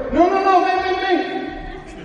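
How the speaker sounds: high-pitched panicked backtrack, dragging out the last words; hammed-up pleading. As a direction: comically beg someone to come back. Climber begs God not to leave. (súplica cómica y desesperada, con voz aguda)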